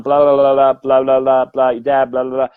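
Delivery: rattled-off filler blahs, run together; going on and on. Retold or imitated. Medium summarising a long reading, droning on. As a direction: babble through the skipped part of a reading. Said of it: "and I'm going on".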